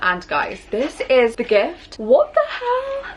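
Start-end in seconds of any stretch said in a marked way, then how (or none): 2.0-3.1 high, slow squeal of disbelief; stunned high-pitched what the hell; shocked. Real, not acted. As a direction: gasp it out, floored by the gift. Opening a stunning gift.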